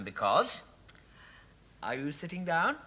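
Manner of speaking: ordinary talk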